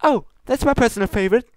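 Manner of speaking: high, quick, bouncing with delight; gleeful. Acted. Pinkie gushes about her favourite cupcake, gleeful. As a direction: gush about a favourite treat. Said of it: "jumping up and down gleefully".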